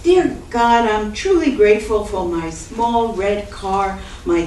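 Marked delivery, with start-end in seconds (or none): none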